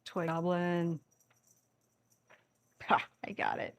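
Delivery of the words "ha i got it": whispered gloating cat. Pretend. Cat voiced stealing toy.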